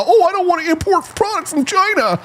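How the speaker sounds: high, whiny mock of a naive objector. Host mocking an imagined objector who won't import from China, high and whiny. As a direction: sneer it in a silly high voice.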